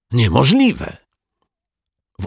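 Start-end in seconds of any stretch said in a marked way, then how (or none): 0.1-1.0 high-pitched disbelief; astonished exclamation. A narrator's reading. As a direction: exclaim it in amazement. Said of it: "krzyknął zdumiony". (ze zdumieniem, podniesionym głosem)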